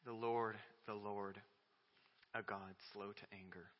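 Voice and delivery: quiet awe over the verse; hushed recitation. Real, not acted. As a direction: let it fall to a reverent hush. Quiet reverent recitation after pause.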